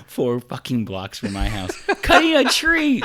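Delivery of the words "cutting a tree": excited. Real, not acted. Continues the excited outburst.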